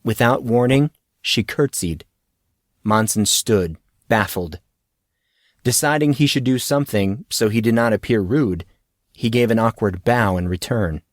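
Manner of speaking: ordinary delivery, nothing marked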